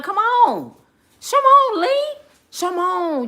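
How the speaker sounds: high, loud beckoning call; high shouted come on, come on. Pretend. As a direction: holler him over to the cookout. Calling Elon over.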